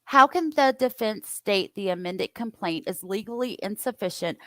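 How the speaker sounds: normal talking voice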